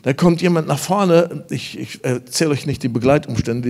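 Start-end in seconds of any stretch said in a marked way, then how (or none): 0.0-1.4 loud, high, fervent preaching shout; louder, higher revival preaching; full-force preaching. Acted. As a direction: preach it out to the hall with fire.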